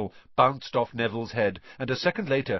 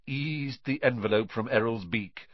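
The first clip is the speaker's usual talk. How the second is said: ordinary delivery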